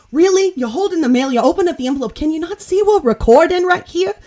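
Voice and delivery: high, loud imitation of an incredulous daughter; Leah Remini. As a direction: tell mother off in mock disbelief. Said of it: "I don't have the best Leah accent".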